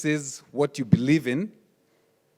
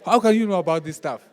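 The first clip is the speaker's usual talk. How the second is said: asked through laughter, louder; laughing disbelief; 5 semitones higher, 7 dB louder, faster than usual. Retold or imitated. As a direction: laugh while asking in amazement. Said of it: "inside I'm feeling like".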